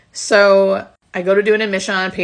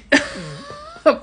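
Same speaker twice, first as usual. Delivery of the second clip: embarrassed laugh. Real, not acted. Laughs after swearing.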